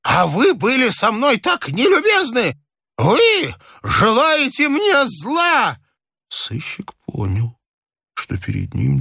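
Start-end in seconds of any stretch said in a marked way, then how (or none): 0.0-5.8 put-on high-pitched accusing old man; agitated, drawn-out high outburst; heated old man. A narrator's reading.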